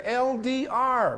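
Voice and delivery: usual speaking voice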